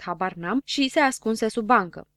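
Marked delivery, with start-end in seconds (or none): none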